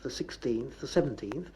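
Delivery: usual speaking voice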